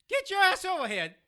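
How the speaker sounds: urgent call. Acted. Calling friend over urgently.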